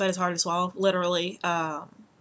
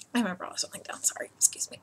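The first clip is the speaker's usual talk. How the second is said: whisper; 5 dB quieter, faster than usual. Real, not acted. Whispered aside.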